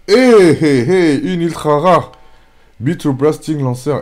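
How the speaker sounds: high, loud hey hey hey; real thrilled shout; excited yell. Real, not acted. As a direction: call out the rare pull in delight. Pulls an ultra rare.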